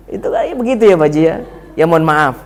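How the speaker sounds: ordinary talk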